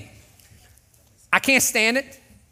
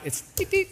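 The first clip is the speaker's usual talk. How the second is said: horn imitation; 11 semitones higher, 5 dB quieter than usual. Shown as a demonstration. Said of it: "It's nice horn".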